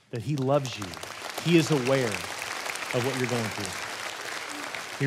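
ordinary talk, nothing marked

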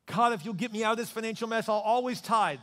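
high, loud pleading prayer; loud desperate plea. Acted. Preacher voicing a desperate believer, loud plea. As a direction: beg God loudly for rescue.

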